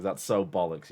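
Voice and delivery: soft, low "That's so bollocks." after the yell; sheepish quiet repeat. Real, not acted. Caught yelling, streamer quietly repeats it to chat. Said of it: "King just yells at the top of his lungs".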